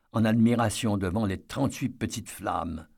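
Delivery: ordinary delivery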